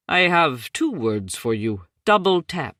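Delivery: a lightly voiced Indian accent, landing the punchline; thick Indian accent. A narrator's reading. Suresh to Packard about the body cam, light Indian accent. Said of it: "in his thick Indian accent".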